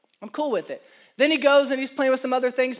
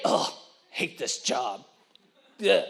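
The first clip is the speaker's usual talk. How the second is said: low, groaning, fed-up store clerk; preacher acting a lazy clerk for the congregation, grumbling; grumbling worker; 8 semitones lower, 5 dB quieter, slower than usual. Sink into a bored, disgusted groan.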